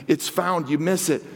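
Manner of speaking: ordinary talk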